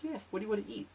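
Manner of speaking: put-on high breezy girly question; girlfriend voice. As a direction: girlfriend shrugging it off, asking about food. Girlfriend casually changes subject.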